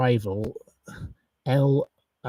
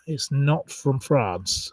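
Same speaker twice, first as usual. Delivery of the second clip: ordinary delivery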